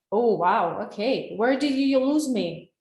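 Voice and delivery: usual speaking voice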